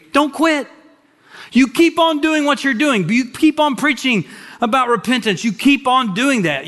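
high, pressing preacher's call; fervent exhortation. Acted. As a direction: drive it home, keep on. Preaching don't quit.

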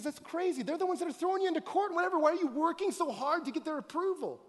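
high, fast, exasperated voicing of James; incredulous James. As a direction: scold them in disbelief. James voiced chiding the church.

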